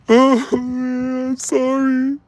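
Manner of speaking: high, wailing mock sobs of a guilty girlfriend; imitating her crying. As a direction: whimper the apology way up high, hammed up. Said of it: "She just busts out crying".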